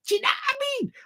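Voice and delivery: very high, squeaky repeat of the catchphrase; comic high-pitched outburst; high squeal. Pretend. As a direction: shoot right up into a squeaky voice.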